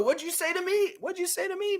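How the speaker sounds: high, indignant squeaky disbelief; offended Peter. Pretend. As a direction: play the insulted friend. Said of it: "Peter would be like".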